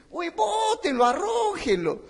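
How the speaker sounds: high indignant call to throw him out; high scandalized shout, half acted; mock outraged order. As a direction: cry out like an offended old executive.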